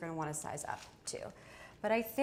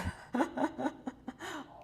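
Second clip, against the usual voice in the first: bright burst of laughter; laughing; 7 semitones higher than usual. Real, not acted. Laughing at being blocked.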